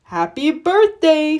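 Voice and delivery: slow, put-on snowman voice, dropping at the end; a newly woken snowman greeting the children, slow. Snowman comes to life and greets the children, slow put-on voice. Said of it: "Frosty said once again".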